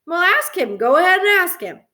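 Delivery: high, loud retort to Mom; defiant. A narrator's reading. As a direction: argue back, indignant. Boy argues back with Mom.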